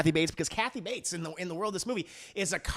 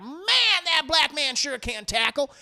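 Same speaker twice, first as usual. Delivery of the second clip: much higher, louder admiring drawl; mock impressed scout; 7 semitones higher, 4 dB louder, slower than usual. College scouts watching footage, high admiring mock voice. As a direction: gush over his tackling.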